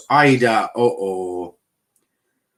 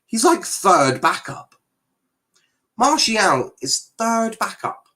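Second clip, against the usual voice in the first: higher and slow, incredulous; mocking disbelief; 8 semitones higher than usual. Real, not acted. Streamer mocks the player's place in the squad, incredulous.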